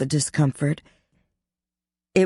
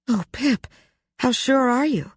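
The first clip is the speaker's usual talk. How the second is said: high anxious friend voice; 10 semitones higher than usual. Acted. Ashley anxious about her friend.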